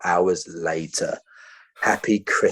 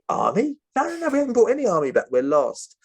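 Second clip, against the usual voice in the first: high, fast, frantic mock denial; panicked denial; 12 semitones higher, faster than usual. Tom denying he brought the army, fast and panicked.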